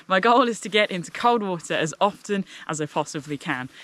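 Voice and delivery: ordinary talk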